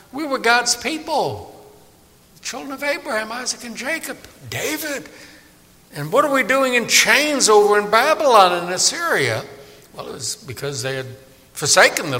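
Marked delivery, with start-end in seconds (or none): 0.1-9.4 much higher pitched, plaintive questioning; puzzled exiles. Retold or imitated. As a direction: voice the bewildered exiles asking why.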